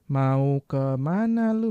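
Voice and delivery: "Mau ke mana lu?" stretched slowly, every word separate; slow full form. Shown as a demonstration. Teacher contrasting unnatural careful speech, slow "Mau ke mana lu?". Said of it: "Beda kan?".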